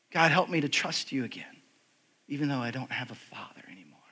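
soft, fading almost to nothing; hushed retold prayer. Young man praying to God for trust, hushed. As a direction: pray it quietly, voice sinking away.

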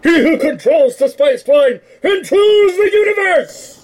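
high, loud laughing proclamation; hammed-up villain shout; grand mock proclamation. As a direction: shout it like a mad emperor. Quoting Dune grandly.

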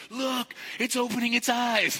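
high, loud thrilled showing off; excited. Retold or imitated. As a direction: gush over the photos. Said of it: "be like".

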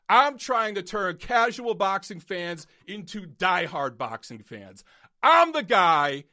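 loud, heated lecture at viewers; angry rant. Real, not acted. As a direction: rant hard, punching each word. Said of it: "That's it for that rant".

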